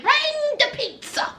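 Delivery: high, singsong food call; oblivious mock order. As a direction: sing it out high, ignoring the scolding. Justine's cheerful oblivious order, acted.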